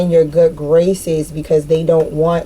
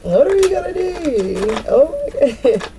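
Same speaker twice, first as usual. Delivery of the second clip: high, rising mock alarm; mock panic; 8 semitones higher than usual. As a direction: ask what to do like scared dependents. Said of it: "I'm hearing this shit in my head".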